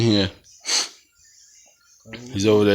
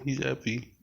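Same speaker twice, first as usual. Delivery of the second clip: normal talking voice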